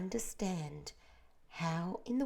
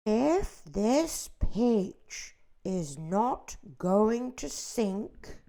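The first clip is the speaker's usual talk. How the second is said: loud, very slow gloom; doomy earthworm; 4 semitones higher, 7 dB louder, much slower than usual. A narrator's reading. The earthworm warns everyone they will starve. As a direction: drag out the worst outcome.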